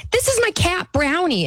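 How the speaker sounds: put-on high excited child's voice; little kid voice. Mom imitating her son showing his cat, high. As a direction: show off a pet like a small boy. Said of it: "And he was like".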